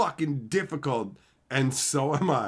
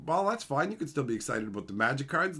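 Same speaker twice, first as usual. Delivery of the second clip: usual speaking voice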